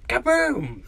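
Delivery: high exclaimed boom; playful cartoon blast in a high voice; mock explosion. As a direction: blurt out an explosion noise, pitched high. Bomb imitates an explosion.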